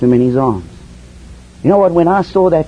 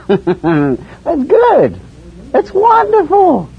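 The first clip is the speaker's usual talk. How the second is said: high, drawn-out joyful exclaim; rapturous praise; delighted; 12 semitones higher, much slower than usual. Real, not acted. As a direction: savour the word wonderful.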